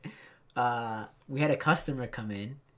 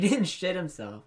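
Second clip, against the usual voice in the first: ordinary talk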